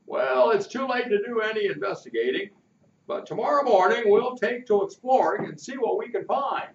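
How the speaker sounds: the captain promising a search tomorrow; kindly captain, planning. Acted. Captain voiced by the reader.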